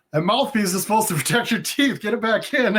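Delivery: laughing while mimicking the scolding; laughing imitation. Vic scolds him for removing his mouthpiece, laughing mimicry. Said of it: "He's like".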